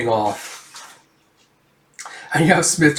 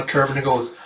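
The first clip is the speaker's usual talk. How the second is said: usual speaking voice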